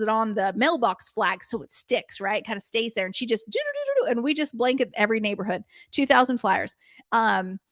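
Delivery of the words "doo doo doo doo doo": humming doo-doo. Retold or imitated. Daughter sticking flyers on mailboxes.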